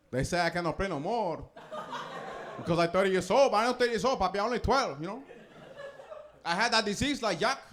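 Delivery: lightly put-on Dominican ballplayer, a touch high; hammed-up Dominican Little Leaguer at the mic; Dominican ballplayer accent. Over-age Little League star speaks at his press conference in a Dominican accent. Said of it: "Little League World Series".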